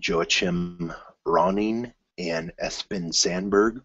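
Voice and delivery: slow, careful try at the Norwegian names; careful attempt. Shown as a demonstration. Vlogger attempting the directors' names, slow and careful. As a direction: spell out "Joachim Ronning" and "Espen Sandberg" slowly. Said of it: "here is my, uh, attempt".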